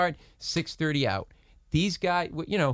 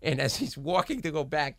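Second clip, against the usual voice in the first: ordinary delivery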